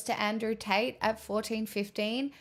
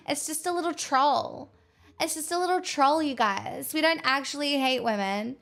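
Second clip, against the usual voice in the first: higher, sing-song mock of men brushing it off; mocking dismissive bros; 4 semitones higher than usual. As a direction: parody their "it's just a joke" whine, pitched up. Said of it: "And everybody is like".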